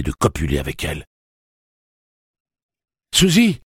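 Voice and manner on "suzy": loud, high-pitched shout of a name; alarmed cry. A narrator's reading. Eddie seeing Susanna attacked, crying out loudly. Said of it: "s'écria Eddie".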